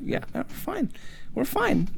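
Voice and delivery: hushed reassurance. Acted. Friends answer the worried guy, soft and high. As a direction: play a quiet, high "we're fine".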